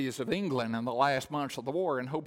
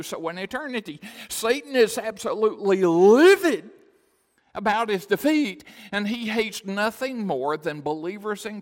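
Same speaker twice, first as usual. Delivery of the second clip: loud, high, emphatic pulpit delivery; thundering sermon warning; preaching at full force; 6 semitones higher, 9 dB louder than usual. Real, not acted. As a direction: drive every phrase home, raised voice. Preacher at full force about Satan.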